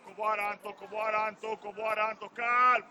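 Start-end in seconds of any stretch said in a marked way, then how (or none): 2.4-2.9 loud, sharp calls; frustrated sideline yell; loud shout. Real, not acted. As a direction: snap it across the pitch. Coach yelling at player.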